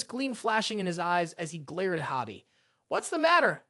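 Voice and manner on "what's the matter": high, mildly taunting question. A narrator's reading. Javi provokes the glaring Canis, taunting. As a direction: lift the pitch to needle him. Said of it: "Javi taunted".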